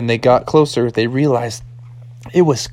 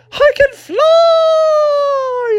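very high, stretched-out cry; slow falsetto flying yell; exultant mock shout; much slower than usual. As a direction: whoop it out as you soar. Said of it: "his dad was like".

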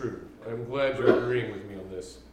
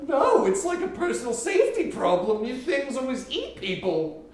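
higher, louder, laughing; indignant fright; 10 semitones higher, 4 dB louder than usual. Acted. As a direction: argue in hammed-up panic. Doctor keeps arguing.

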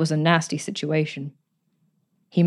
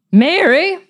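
high, loud summons up the house; commanding shout of a name; imperious call; 11 semitones higher, 7 dB louder than usual. Acted. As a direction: call the girl like you own her. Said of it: "she called imperiously".

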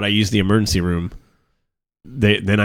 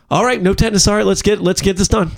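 higher, hammed-up forced cheerfulness; mock fake cheer; 8 semitones higher than usual. Nurse returning after cooling off, mock cheery and higher. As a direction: imitate a nurse grinning through her anger. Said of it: "a big fucking smile".

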